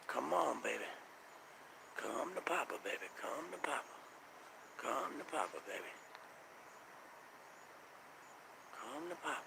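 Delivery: a soft high croon to a pet; coaxing. Real, not acted. Coaxing the UFO closer.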